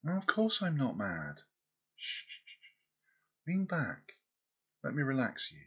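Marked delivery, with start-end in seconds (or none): 2.0-2.6 whispered shh, shh, shh; soft shushing. Acted. Lover calming her anxious girl with whispered shushing. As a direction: hush them gently.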